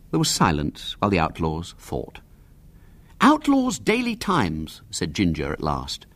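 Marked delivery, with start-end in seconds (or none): 3.2-4.8 put-on high-pitched, slow boy's voice; eager high boy voice; boy's suggestion. A narrator's reading. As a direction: pitch it high and draw it out like a schoolboy proposing a title. Narrator voices Ginger, a boy.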